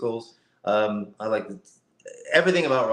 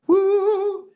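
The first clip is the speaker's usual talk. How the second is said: excited whoop; 5 dB louder than usual. Real, not acted. Lighting comes back on.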